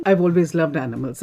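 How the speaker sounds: ordinary talk, nothing marked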